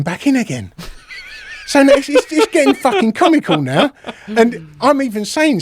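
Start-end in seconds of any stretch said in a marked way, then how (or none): 1.6-3.9 high-pitched, laughing through the words; real amusement breaking into the storytelling; amused disbelief. Real, not acted. Teller laughing at how absurd the standoff became, high and amused.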